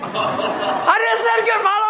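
exasperated wife. Retold or imitated. Wife repeating herself to grumpy husband, exasperated half-shout. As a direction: throw it up in exasperation. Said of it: "You know?".

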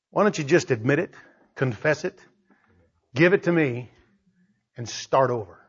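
soft God. Acted. God's soft invitation.

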